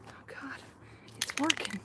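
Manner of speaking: breathy whisper. Real, not acted. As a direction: gasp it softly. Fumes, breathless.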